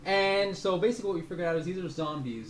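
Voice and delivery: normal talking voice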